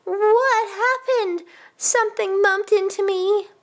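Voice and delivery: high-pitched granny, stretching the first question; bewildered old woman. Acted. Old woman asking what hit her, high and bewildered. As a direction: puzzle it out as a dazed elder.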